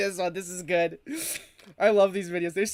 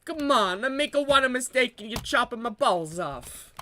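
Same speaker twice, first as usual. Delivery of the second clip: hammy Italian-American complaint; mock Italian accent; 2 semitones higher than usual. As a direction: protest in a put-on Italian accent. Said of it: "the filthy Italian hippie".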